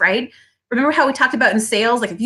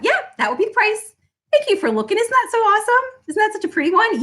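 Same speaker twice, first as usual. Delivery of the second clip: cheerful sales reply; 11 semitones higher, faster than usual. Shown as a demonstration. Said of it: "everybody say it out loud".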